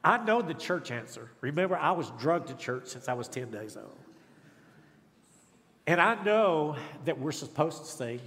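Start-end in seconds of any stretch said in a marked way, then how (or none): none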